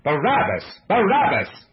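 building crowd cry; swelling cry. A narrator's reading. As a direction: shout it, then shout it harder. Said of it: "Louder and louder swelled the cry".

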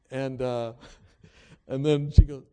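ordinary talk

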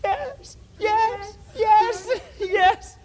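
high, joyful cries of yes. Acted. Daniel answers the king from the den, joyfully. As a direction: call back up in joy.

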